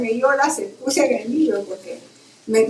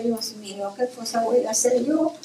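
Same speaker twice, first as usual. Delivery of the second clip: hushed worry; 7 dB quieter than usual. Retold or imitated. Retold worried thought.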